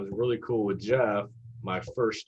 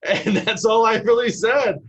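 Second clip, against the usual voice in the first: much higher and louder; amused disbelief; 12 semitones higher, 9 dB louder than usual. Real, not acted. Telling the press story, high and excited. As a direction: crack up at your own blunder. Laughing at his own blunder.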